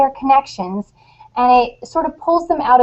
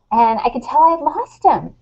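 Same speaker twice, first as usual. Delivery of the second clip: ordinary talk, nothing marked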